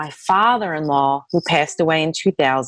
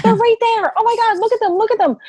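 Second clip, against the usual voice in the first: insistent amazement; faster than usual. Retold or imitated. To her husband about his dead relatives, very high insistent. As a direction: make him look right now.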